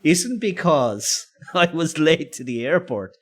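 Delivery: can barely get it out for giggling; amused retort broken up by laughter; laughing. Retold or imitated. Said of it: "And I start to laugh".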